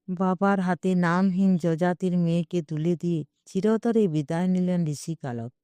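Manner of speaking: usual speaking voice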